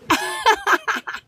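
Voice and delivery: a sharp, very high scoffing laugh; high mocking laugh. Real, not acted. Laughing off the hater's comment.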